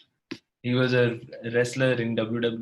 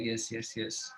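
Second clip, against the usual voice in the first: soft mournful agreement; 10 dB quieter, slower than usual. Retold or imitated. Bereaved family accepts polite phrase.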